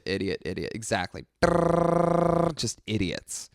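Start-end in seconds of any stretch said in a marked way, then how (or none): 1.4-2.6 robot scan noise. Pretend. Scanning room Terminator style.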